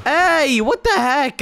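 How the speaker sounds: high, loud outraged protest; indignant yell. Real, not acted. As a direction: shout out in outrage. Guards fail, enemy gets in.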